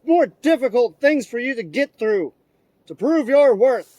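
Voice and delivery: loud, solemn mission briefing to the troops; rally the tomahawks like soldiers; mock commander's speech.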